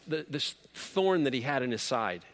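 normal talking voice

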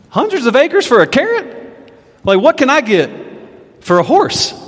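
high, incredulous squeak of disbelief; a scheming nobleman scoffing at a carrot's reward, high and incredulous. Acted. Nobleman at court, scoffing in high disbelief. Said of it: "and he's like".

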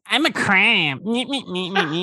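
high squeaky crab, laughing; silly crab voice. Acted. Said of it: "This is crab voice".

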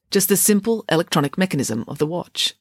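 ordinary delivery

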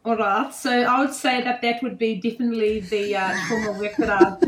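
put-on South African accent. Shown as a demonstration. Guest answers host's question about worthwhile work, South African accent. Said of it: "start out with your South African accent".

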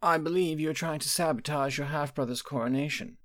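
a hint of a knowing old sorceress; mother calmly probing her daughter, lightly voiced; aging mother. Quintilla, the dowager, speaks.

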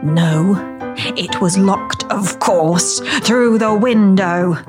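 slow, drawn-out scorn; contemptuous. A narrator's reading. Dan answers Shona as an imbecile, contemptuous. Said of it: "Dan's tone was one of contempt".

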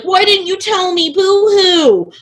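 high, whiny mock complaint; whiny Fernand. Retelling the plot, she mocks jealous Fernand whining. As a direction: whine it like a jealous friend. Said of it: "is like".

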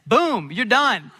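loud, slow, punched-out mock sign-off; emphatic cut-off. Boy hanging up on his dad mid-sentence, emphatic cut-off. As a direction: cut him off with a big drawn-out boom.

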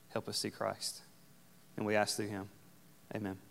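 hushed, reverent congregational prayer. Real, not acted. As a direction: speak softly to God, unhurried.

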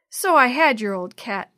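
loud, grudging admission; sour. A narrator's reading. Tevin confessing to Jenny about the cat, loud and sour. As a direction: admit it sourly, on the defensive. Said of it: "Tevin admitted sourly".

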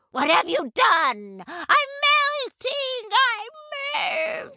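high, slow, wailing shriek; melting witch scream. A narrator's reading. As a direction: scream as she melts away. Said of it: "the wicked woman screamed".

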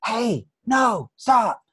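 put-on sharp high yell, stopping a child; mother's mock yell of alarm; mom yelling. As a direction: shout it up high to stop the kid. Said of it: "just yelled at me".

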